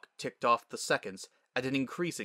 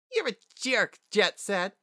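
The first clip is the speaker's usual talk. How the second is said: put-on much higher, angry young voice; indignant young filly; 10 semitones higher, 4 dB louder than usual. Tripped filly glares up at her bully, indignant. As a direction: fire back at the bully. Fallen Sunset snaps at bully.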